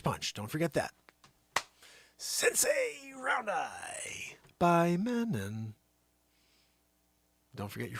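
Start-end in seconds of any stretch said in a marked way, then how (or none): 2.2-5.7 slow breathy perfume-ad whisper; hushed commercial slogan; whispered tagline. Acted. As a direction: sell it like a luxury ad. Mock product tagline.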